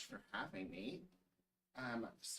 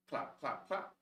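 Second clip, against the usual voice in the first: plain reading voice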